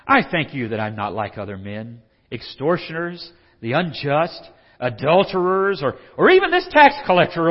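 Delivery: loud, high show-off prayer; boastful Pharisee praying. Acted. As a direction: pray loudly to impress God. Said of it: "Like he's trying to impress God".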